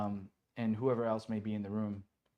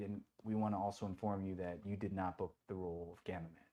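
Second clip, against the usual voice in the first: breaking bad news; 4 semitones lower, 9 dB quieter than usual. Producers telling actor he lost role, subdued. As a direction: lower and softer, measured retelling.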